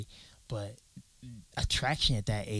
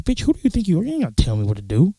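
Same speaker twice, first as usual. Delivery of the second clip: indignant retort; 5 semitones higher, 5 dB louder, faster than usual. Retold or imitated. Retort to neighbor about speeding.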